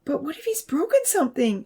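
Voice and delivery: high, worried plea; anxious high-pitched question; pleading. A narrator's reading. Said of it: "Abby pleaded".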